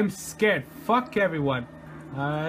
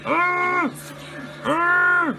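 two high, held "ah" cries; panicked screams; 8 semitones higher than usual. Real, not acted. Player chased in horror game, high panicked screams.

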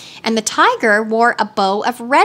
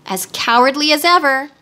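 usual speaking voice

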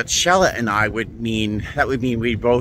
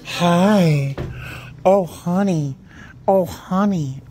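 high-pitched coo at a kitty; 7 semitones higher than usual. Real, not acted. Cat lover greeting a kitty, cooing. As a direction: coo with gasping, melting delight.

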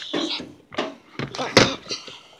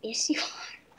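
whispering; 2 semitones lower, 11 dB quieter than usual. Real, not acted. Teasing mom about cooking.

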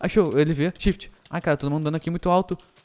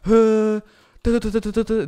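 imitated hit sounds; 6 semitones higher, 6 dB louder, much slower than usual. Mimicking hits in gameplay.

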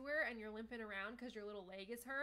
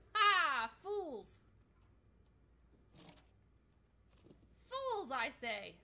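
gloating villain; 4 semitones higher, 10 dB louder, much slower than usual. Acted. Gloating villain after cackle.

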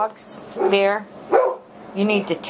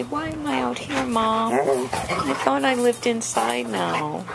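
higher, slow voicing for the dog; 7 semitones higher than usual. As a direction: speak for the dog, lightly.

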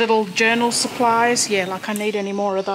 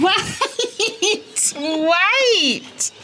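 laughing wait; 7 semitones higher than usual. Real, not acted. Laughing while holding the horse back.

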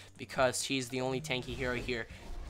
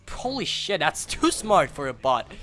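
much higher, stunned outcry; shocked disbelief; 9 semitones higher, 5 dB louder than usual. Real, not acted. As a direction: react in disbelief at the boss. Shocked by a tough boss.

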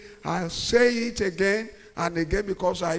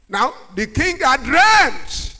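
loud, higher storytelling; fiery preaching; 5 semitones higher, 7 dB louder than usual. Acted. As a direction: preach the tale with fire.

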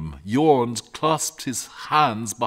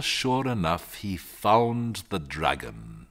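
usual speaking voice

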